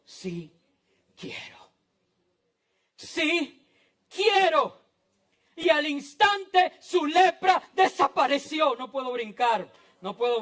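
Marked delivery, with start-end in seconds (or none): 0.0-1.7 very soft and low, pausing between words; hushed answer. Acted. First quiet take of Jesus' answer. 3.0-4.8 raised, high and forceful, word by word; ringing declaration with a pause between words; emphatic shout. Acted. Same answer repeated at full force. 5.5-8.8 high, loud preaching cry; ringing pulpit shout; exultant proclamation. Real, not acted.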